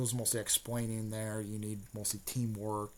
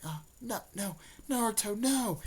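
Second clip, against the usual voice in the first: higher mock cry of alarm, rising; rising hammed-up dismay; Hinata dismayed; 10 semitones higher than usual. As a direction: plead in a pitched-up girl's voice. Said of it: "She's like".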